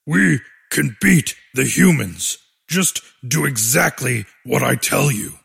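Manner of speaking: deep, firm order; low commanding voice. Acted. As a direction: take charge in a low voice.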